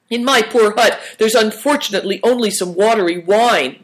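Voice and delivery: high-pitched mock-woeful lament; bewailing. A narrator's reading. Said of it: "bewailed Uncle Chen".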